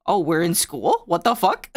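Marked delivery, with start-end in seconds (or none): none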